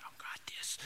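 imitating a tense hunter's whisper. Old hunter aiming at the turkey, nervous whisper. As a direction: breathe it out unvoiced, scared to spook the bird. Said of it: "He's just like".

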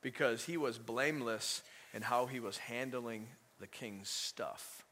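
normal talking voice

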